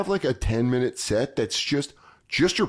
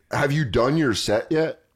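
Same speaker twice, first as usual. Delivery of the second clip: ordinary delivery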